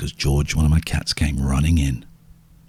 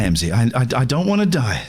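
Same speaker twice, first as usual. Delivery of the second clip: ordinary delivery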